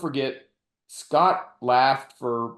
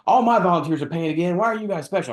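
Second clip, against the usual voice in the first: snippy; 6 semitones higher, 4 dB louder, faster than usual. Retold or imitated. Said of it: "she immediately got snippy with me".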